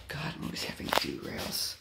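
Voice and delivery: soft unvoiced mutter to himself; whispering while fixing the track. Real, not acted. Hobbyist fixing derailed train, muttering to himself. Quiet fiddling after derail.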